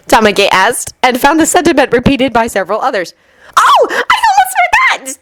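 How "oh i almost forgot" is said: put-on very high excited little girl shout; squeaky child suddenly remembering; child announcing. A narrator's reading. Kirimi remembering the second invitation, high child shout. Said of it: "Kirimi announced".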